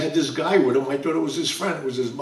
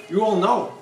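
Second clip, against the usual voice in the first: ordinary delivery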